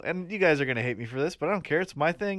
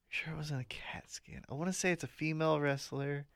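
toneless whisper while thinking; 14 dB quieter than usual. Real, not acted. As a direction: whisper it under your breath. Whispering while trying to recall.

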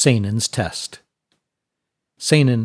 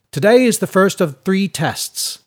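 high, loud schoolmaster's announcement; announcing rector; 8 semitones higher, 5 dB louder than usual. Acted. Rector hands out test papers, announcing loudly. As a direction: project it to the whole classroom.

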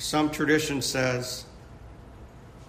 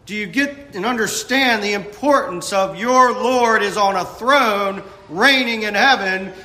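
ordinary talk

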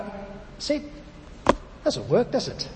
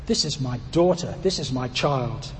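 tender, quiet; 8 semitones lower, 5 dB quieter than usual. Pretend. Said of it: "God was saying to everyone everywhere".

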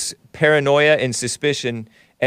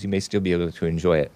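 ordinary talk

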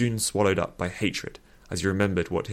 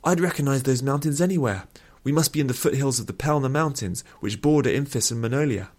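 eager; 7 semitones higher, 3 dB louder than usual. Acted. Elran eager about home.

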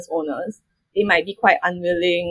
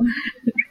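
high laugh; 4 dB quieter than usual. Real, not acted. Laughs after trailing off.

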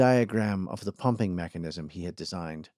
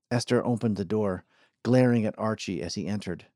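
ordinary delivery

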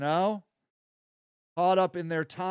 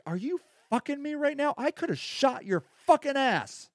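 retold angry outburst; 9 semitones higher than usual. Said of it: "I was angry".

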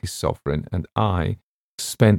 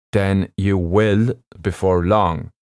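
slow, grave warning; measured and solemn, drawn out; slower than usual. A narrator's reading. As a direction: say it slowly and gravely. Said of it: "he said very gravely".

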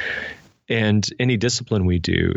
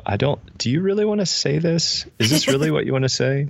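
mock doubtful editor, pitch jumping higher; imitating an editor; 7 semitones higher than usual. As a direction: ask it like a skeptical editor. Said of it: "your editor will say to you".

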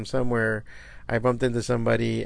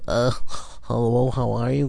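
snotty voice. Retold or imitated. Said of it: "kind of voice".

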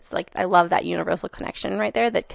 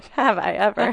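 laughing through the words; 4 semitones higher, 6 dB louder than usual. Real, not acted. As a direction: giggle it out. Laughing at all-nighters joke.

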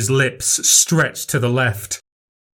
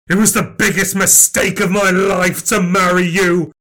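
much higher, loud regret; bitter outburst; 8 semitones higher, 3 dB louder than usual. Acted. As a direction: spit out the regret. Final bitter declaration.

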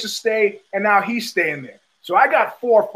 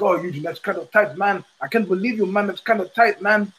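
put-on French accent. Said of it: "It's my French accent".

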